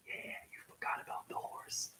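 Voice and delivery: breathy whispered aside; a conspiratorial whisper. Acted. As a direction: whisper it like a secret. Whispered aside after monologue.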